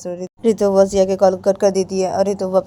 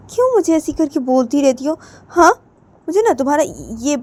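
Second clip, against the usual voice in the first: higher-pitched, annoyed protest; irritated scolding; 7 semitones higher, 5 dB louder than usual. Acted. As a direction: scold them in a raised pitch. Acted scolding over I love you. (चिढ़कर ऊँचे सुर में डाँटते हुए)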